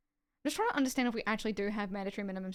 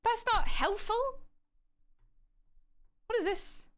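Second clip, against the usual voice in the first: exasperated; 12 semitones higher, 5 dB louder than usual. Real, not acted. Exasperated reaction to the textbook.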